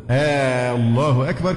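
stretched first vowel, آلله, then أكبر; long opening madd. Shown as a demonstration. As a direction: hold the hamza of الله long before أكبر. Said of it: "إذا حصل مد في الإيه؟". (مد الهمزة الأولى في «الله»)

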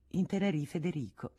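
plain reading voice

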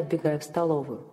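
ordinary delivery, nothing marked

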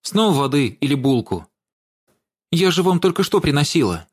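complain, fed up with her; irritated complaint. A narrator's reading. Girl irritated with grandmother.

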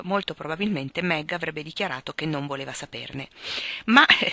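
ordinary delivery

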